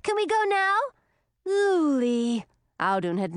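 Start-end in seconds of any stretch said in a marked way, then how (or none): 0.0-0.8 high-pitched, eager little dragoness; small, high, impatient voice; excited child. A narrator's reading. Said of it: "her eyes wide with excitement". 1.5-2.5 higher-pitched, exasperated name call; mild sisterly reproach; scolding. A narrator's reading. Hildy scolds interrupting little sister.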